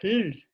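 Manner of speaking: hanya bunyi pl tanpa vokal; isolated consonant cluster. Shown as a demonstration. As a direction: hembuskan "Pl" saja, tanpa vokal. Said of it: "uno se da cuenta que es esto".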